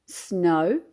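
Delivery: usual speaking voice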